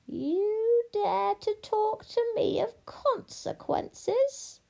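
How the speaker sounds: drawn-out witch voice, high then sinking; menacing witch. Acted. Witch challenges the princess, slow and menacing. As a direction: stretch each word with slow menace.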